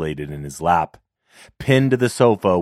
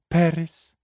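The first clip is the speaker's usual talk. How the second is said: put-on girlish voice; 10 semitones higher than usual. Girl gives her name.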